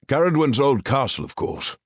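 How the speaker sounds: talking through a mouthful; mouth full. Acted. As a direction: speak around a bite of bacon. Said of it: "through a mouthful of bacon".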